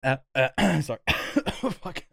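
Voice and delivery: strained, choked-up voice after coughing; choking cough. Real, not acted. Choked by a cough while watching a war scene, strained voice. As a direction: croak it out, throat still catching. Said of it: "in my wrong throat".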